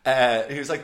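ordinary delivery

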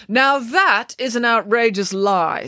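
slow, high, emphatic outrage; offended storybook nanny; indignant. A narrator's reading. Nanny indignantly disputes the proverb.

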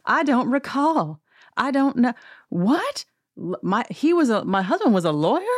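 high, mock-innocent denial; hammed-up innocent confusion; feigned cluelessness. Mimics Erica playing clueless.

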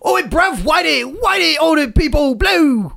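laughing London lad; British accent. Pretend. British character shouts.

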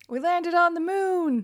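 high, loud, slow goofy movie-line voice; Dumb and Dumber quote. Retold or imitated. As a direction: ham up the dopey movie line, drawn out. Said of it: "the scene where".

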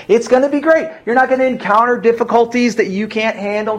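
high-pitched parody of breezy reassurance; mock rosy promise. Preacher imitating people who misquote promises to believers, mockingly upbeat. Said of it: "this is the mistake that people have made".

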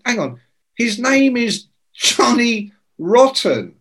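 high-pitched, halting incredulity; astonished, stretching the name out; retold disbelief. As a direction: spell out the absurd name word by word.

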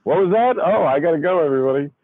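high, startled, as if called away; mock startled reply. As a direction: pretend someone just called you from another room. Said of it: "Are you pretending Joe yelled at you".

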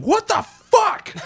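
drawn-out, high-pitched disbelieving yell. Retold or imitated. Podcaster imitating a comic fan's freak-out, yelling. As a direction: yell it slowly, high and loud. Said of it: "where he's yelling".

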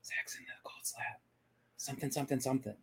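mimicking someone's indistinct whispering; mock whisper, voiceless and trailing. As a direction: whisper it like muffled chatter picked up on a recording. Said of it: "all I'm gonna hear ... is just whispers".

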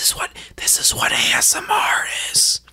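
ASMR whisper; breathy whispering into the mic. Shown as a demonstration. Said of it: "you're supposed to whisper".

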